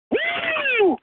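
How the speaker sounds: a high cheering woo. Real, not acted. Fan's whoop at the end of his voicemail. Fan ends call cheering.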